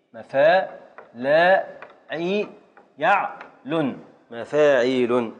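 measured teacher's breakdown, vowels held; syllable-by-syllable scansion. Shown as a demonstration. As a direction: separate syllables with gaps, alternating verse and pattern. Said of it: "قطع لنا البيت".

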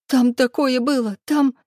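high, tearful stammer; crying. Acted. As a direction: stutter through sobs. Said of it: "заикаясь от слёз, провыла".

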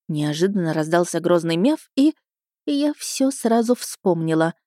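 usual speaking voice

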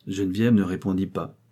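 plain reading voice

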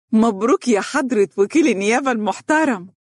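voiced mother, delighted and proud; proud congratulations. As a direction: congratulate your son with lifted pitch. Mother congratulates her son on his post.

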